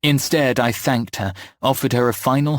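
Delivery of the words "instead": plain reading voice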